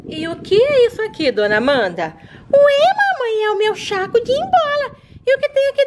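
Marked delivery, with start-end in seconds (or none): 0.1-2.2 lower, calm motherly voice; low reassuring mom. Acted. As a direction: soothe the little one as mom. Mother reassures runaway baby.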